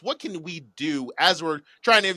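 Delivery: usual speaking voice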